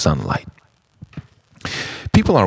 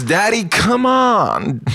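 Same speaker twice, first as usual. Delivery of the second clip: much higher, slow whiny call; childish plea; slower than usual. Acted. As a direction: whine it like a child calling. High slow shouted plea.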